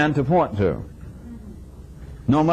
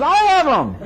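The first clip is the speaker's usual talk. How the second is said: high, loud insistence with a laugh; hammed-up greedy client; mock demanding customer; 14 semitones higher, 3 dB louder than usual. Demanding customer voiced in anecdote.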